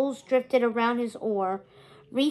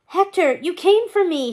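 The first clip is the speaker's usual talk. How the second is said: high, loud cry of relief; 6 semitones higher, 6 dB louder than usual. A narrator's reading. Captive May sees Hector has come, crying out. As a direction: call out to your rescuer, high and urgent. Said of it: "she cried".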